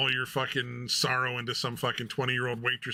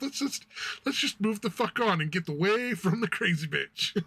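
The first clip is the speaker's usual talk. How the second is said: much higher, hushed urgent voice; older lady; 5 semitones higher, 4 dB louder than usual. Retold or imitated. Older lady steering the streamer away, much higher voice. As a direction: coax a friend away from trouble. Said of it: "she's just like".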